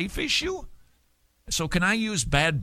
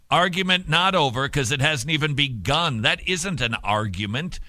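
ordinary talk

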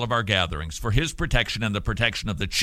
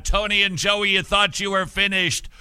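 higher, louder gloating villain; wild unhinged ranting; 8 semitones higher, 3 dB louder than usual. Pretend. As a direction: taunt them like a cartoon villain. Host gloats at his co-hosts.

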